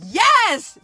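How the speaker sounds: high, loud joyful shout; loud happy shout of yes. Shown as a demonstration. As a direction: shout it out with everything. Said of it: "give a, a loud shout out like".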